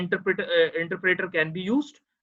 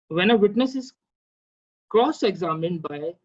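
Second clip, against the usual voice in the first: louder, measured legal reading; reading statute aloud; 4 dB louder than usual. Real, not acted. Lecturer reading the evidence section to students, formal read-aloud. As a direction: read out the section slowly and forcefully.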